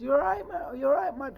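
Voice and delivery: very high, loud mock Irish greeting; strong Irish accent. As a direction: mimic an Irishman in a high-pitched voice. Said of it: "He had that Irish strong accent".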